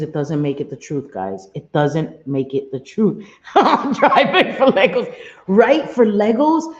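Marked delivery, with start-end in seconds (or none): 3.5-6.7 loud, high, laughing incredulity; laughing disbelief. Real, not acted. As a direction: laugh it out, incredulous at the silly claim. Laughing at absurd accusation.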